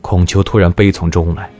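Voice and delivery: ordinary delivery, nothing marked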